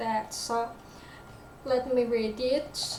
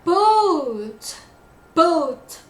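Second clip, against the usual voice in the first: BOAT high, loud and falling, each time; emphatic, isolated BOAT; stressed BOAT; 4 semitones higher, 7 dB louder than usual. Shown as a demonstration. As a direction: punch each BOAT separately. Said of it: "It's a stress word".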